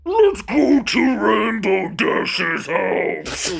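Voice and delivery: loud, drawn-out goofy holler; hammed-up slow silly shout; silly excited voice. Co-player plays gushing new friend, loud silly holler. Silly voice after boy-band joke.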